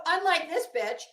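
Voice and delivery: normal talking voice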